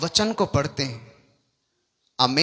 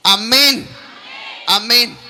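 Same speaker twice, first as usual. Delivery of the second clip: loud, raised call to the congregation; punchy shouted sign-off to a prayer; shouted amen; 4 semitones higher, 6 dB louder, much slower than usual. Real, not acted. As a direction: call out a forceful closing amen.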